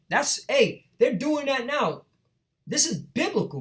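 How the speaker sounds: high, fired-up preaching; rising with a preacher's fervour; excited. Real, not acted. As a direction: pitch jumps up in excitement. Preacher excited by prophecy fulfilled.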